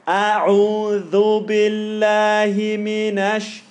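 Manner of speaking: slow chanted "A'udhu billahi minash"; Quranic recitation. Shown as a demonstration. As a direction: recite it slowly with a drawn-out "A'udhu". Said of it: "So all of that is fine".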